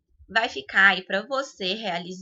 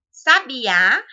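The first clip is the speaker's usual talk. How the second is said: Sabiá loud, with the final Á punched; stress on last syllable; 3 semitones higher, 10 dB louder than usual. Shown as a demonstration. Teacher giving an oxytone example, stress on Á in Sabiá. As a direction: hit and hold the Á ending Sabiá. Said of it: "o A".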